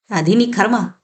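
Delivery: usual speaking voice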